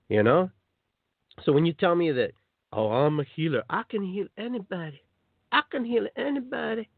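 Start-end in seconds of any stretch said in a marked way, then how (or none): 2.7-6.9 high, showy mock self-praise; mock boastful healer. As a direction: parody a smug healer bragging. Mocking self-proclaimed healer.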